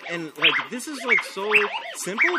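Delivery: usual speaking voice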